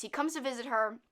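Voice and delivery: normal talking voice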